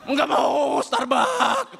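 loud refusal rising to a high shout; sulking possessed person. Acted. Possessed person refuses the coffee offered, sulking shout. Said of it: "Orang kesurupan lagi ngambek".

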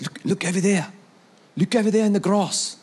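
a hint of a South African accent; tour guide impression. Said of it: "It's pretty good, huh? I've been working on it".

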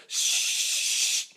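long unvoiced "shh" of burning gunpowder; hissing fuse imitation. Storyteller imitating a lit string of gunpowder, hissing. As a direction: imitate a lit powder trail racing away. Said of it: "and it goes".

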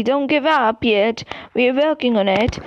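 usual speaking voice

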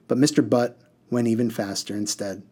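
ordinary delivery, nothing marked

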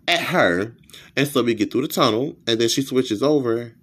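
ordinary delivery

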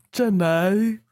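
mock Southern call to a sweetheart; drawn-out Southern impression; put-on, yearning Southern drawl; Forrest Gump. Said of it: "trying to have a Forrest Gump moment".

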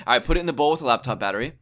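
hammed-up cooking-show scammer; mock cheerful scammer.

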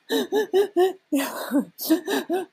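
high mock sobbing gasps; hammed-up crying gasps; imitated sobbing. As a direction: act out tearful, gulping breaths. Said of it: "tears were streaming down his face".

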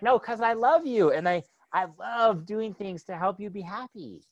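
put-on dad telling kids why, high and loving. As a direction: say it like a fond father.

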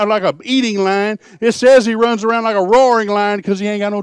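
fiery revival preaching; full-force preaching. Acted. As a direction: thunder it out to the pews.

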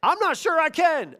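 a preacher acting out a doubter's fear, pitched high; mock timid doubt. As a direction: voice the hesitant believer. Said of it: "You say".

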